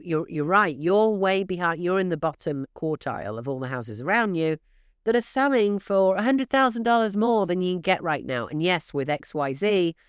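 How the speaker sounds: normal talking voice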